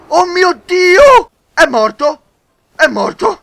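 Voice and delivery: high, loud shocked cry; excited disbelief. Real, not acted. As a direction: exclaim in shocked delight. Enemy killed by rhino. (incredulità eccitata, acuta e forte)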